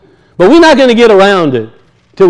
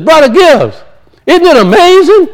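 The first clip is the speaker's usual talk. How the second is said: high, bright-eyed gushing; excited high-pitched preacher greeting; cheerful greeting; 10 semitones higher, 3 dB louder than usual. Retold or imitated. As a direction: gush with delight at a friend. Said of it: "Preacher gets over there and says".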